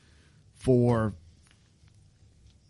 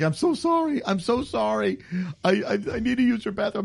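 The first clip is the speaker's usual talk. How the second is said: high-pitched, frantic apology, rushed at the end; desperate apology; 7 semitones higher than usual. Retold or imitated. Driver begging a homeowner for her bathroom, high frantic pleading. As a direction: beg like someone who can't hold it. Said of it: "He's like".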